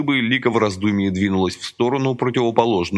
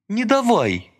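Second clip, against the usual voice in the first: pleading; 12 semitones higher, slower than usual. A narrator's reading. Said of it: "взмолилась Лика".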